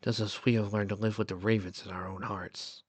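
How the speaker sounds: low, soft, understated sadness; gently subdued and regretful; touch of sorrow. A narrator's reading. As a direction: let a little sorrow quieten the voice. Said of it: "a touch of sorrow in his voice".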